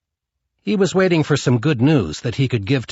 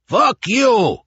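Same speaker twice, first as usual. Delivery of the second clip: a huge, much higher, louder roar; bellowed; 11 semitones higher, 5 dB louder than usual. A narrator's reading. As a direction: bellow it in defiance at the gunman. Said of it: "he bellowed".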